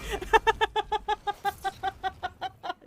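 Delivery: high-pitched burst of laughter. Real, not acted. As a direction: let the laugh spill out high. Laughing at another player's misplay.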